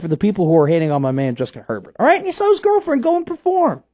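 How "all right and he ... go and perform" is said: pitched way up, pushing fast at the end; rising outrage on his guy's behalf; worked-up defense. Real, not acted. Co-host defending the quarterback to his radio partner, worked-up and high.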